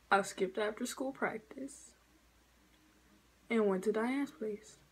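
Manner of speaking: soft, hushed admission; quiet confession. Retold or imitated. Teen retelling her timid confession to her mama, soft. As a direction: confess it under your breath.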